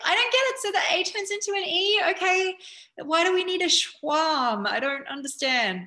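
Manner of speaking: high, loud baffled whine; mock confusion. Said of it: "It gets me really confused".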